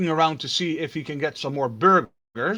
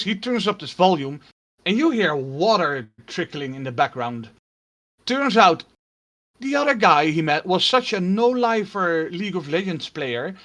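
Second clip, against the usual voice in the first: usual speaking voice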